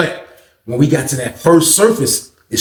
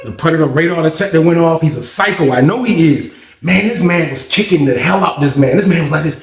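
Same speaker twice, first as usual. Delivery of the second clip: ordinary talk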